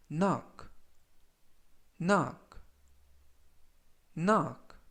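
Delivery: careful vocabulary drill, one word at a time; measured teacher's demonstration with long gaps; slow model reading. Shown as a demonstration. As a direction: read it out slowly for learners to repeat. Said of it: "천천히 세 번씩 읽어드리고요".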